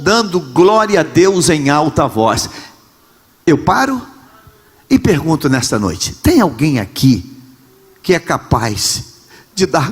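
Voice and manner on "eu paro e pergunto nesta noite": ordinary delivery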